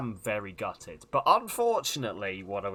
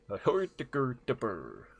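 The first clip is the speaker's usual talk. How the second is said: garbled mock-Swedish sing-song; Swedish Chef voice. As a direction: stumble through it in a comic Swedish accent. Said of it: "the Swedish chef voice".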